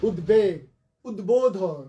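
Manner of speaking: উদ্বেগ, উদ্বোধন as ud-beg, ud-bodhon; b sounded. Shown as a demonstration. Teacher illustrating the rule, b sounded firmly. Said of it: "সেখানকার বফলাটা উচ্চারিত হবে".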